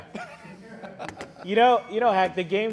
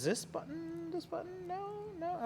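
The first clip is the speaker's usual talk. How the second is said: puzzled high voice; 13 semitones higher, 15 dB quieter than usual. Real, not acted. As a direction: wonder aloud which button. Fumbling with the buzzer.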